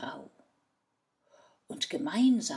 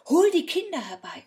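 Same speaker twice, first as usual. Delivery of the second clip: high, loud, fast eager order; excited command; 11 semitones higher, 12 dB louder, faster than usual. Acted. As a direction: hurry them to fetch the kids, thrilled. Excited man orders children fetched.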